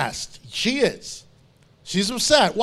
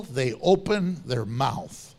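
slow low punchline; 9 semitones lower, 3 dB quieter, slower than usual. Real, not acted. Punchline about Job's friends.

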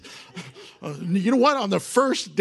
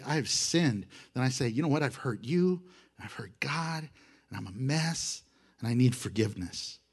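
humble confession; 4 semitones lower, 6 dB quieter than usual. Shown as a demonstration. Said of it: "But if I say".

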